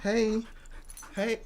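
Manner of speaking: normal talking voice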